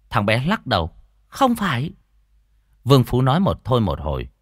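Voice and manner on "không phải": put-on high-pitched child voice; boy saying no. Boy rejects man's guess, high childish voice. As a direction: refuse it in a little boy's high voice.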